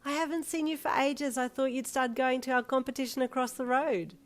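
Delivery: high, chatty waitress voice; friendly waitress. A narrator's reading. Waitress catching up with Mister Lin, friendly.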